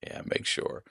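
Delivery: whisper. Retold or imitated. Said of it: "I'm like".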